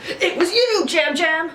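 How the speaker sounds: very high, loud theatrical announcement; dramatic host reveal. Retold or imitated. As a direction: unveil the culprit with big drama. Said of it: "so dramatic".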